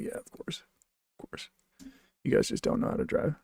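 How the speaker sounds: quick sarcastic aside under the breath; sarcastic under-breath mutter. Retold or imitated. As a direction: mutter it sarcastically to yourself, fast. Mocking other drivers.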